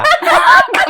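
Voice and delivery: big, high peal of laughter; loud laugh. Real, not acted. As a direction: crack up loudly at the joke. Shared joke about heart-shaped mango. (ज़ोर का ठहाका)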